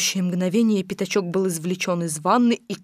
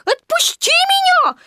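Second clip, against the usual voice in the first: very high pleading piglet squeal; 4 dB louder than usual. A narrator's reading. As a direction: squeal to be let go. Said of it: "пищал Пятачок". (отчаянный писк поросёнка)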